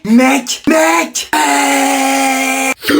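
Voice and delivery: high, long-drawn call to a mate; insistent calling. Acted. Calling to friend online, louder.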